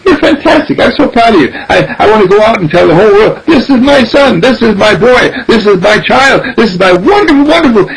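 high-pitched, effusive praise; gushing proud father. Acted. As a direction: boast about your child to the whole world. Voices a proud father praising his son.

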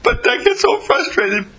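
very high, whiny-caller falsetto; imitated frustrated woman. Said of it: "And she said".

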